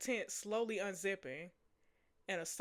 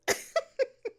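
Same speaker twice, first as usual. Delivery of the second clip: loud squealing giggle; high laugh; 8 dB louder than usual. Real, not acted. Laughing at her flirt.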